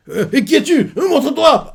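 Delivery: the dog calling out in a panic; panicky. Retold or imitated. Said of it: "Rhodes commence à paniquer".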